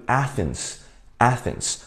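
Athens 的 A 读短音，像 Apple 的 a; 示范 Athens 首音短 a; read Athens. Shown as a demonstration. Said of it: "我们这个要读Athens". (老师示范 Athens 发音)

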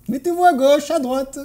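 high-pitched stage direction; acted high, bossy voice; put-on instructor. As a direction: give orders in a high sing-out. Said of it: "On me disait".

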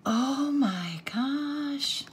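high, very slow, stretched-out amazement; long marvelling exclamation; awestruck. Real, not acted. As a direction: draw each word out in wonder. Awestruck on opening the gift.

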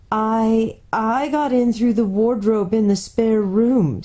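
slow, a lightly voiced young girl; girl character voice. A narrator's reading. The girl tells the faun her name in a light, slow child voice. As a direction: answer simply and slowly, as a little girl.

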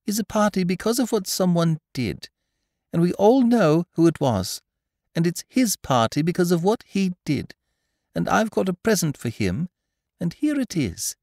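high-pitched, proud announcement; Christopher Robin's speech-making. A narrator's reading. Christopher Robin presenting a gift at the party, high announcing voice.

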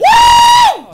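very high, loud whoop; whooping cheer. Real, not acted. Streamer whooping over a glove drop, high and loud. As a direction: let out a huge victory whoop.

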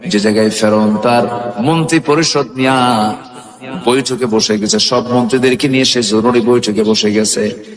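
melodic sermon chant on long held notes; সুরেলা ওয়াজ. A narrator's reading. Preacher chants the story melodically. (সুর করে টেনে টেনে ওয়াজের বয়ান)

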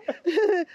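short, high nervous giggle; nervous laugh. Retold or imitated. Describing how she was laughing about her mum, nervous giggle. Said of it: "it's that nervous".

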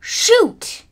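sharp high yelp of frustration; frustrated shout. Real, not acted. Gamer yelling at the screen in frustration. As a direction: yell it as the plan fails.

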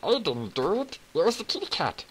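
lightly put-on voice of a blaming dog; doggy voice. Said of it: "Doggy goes".

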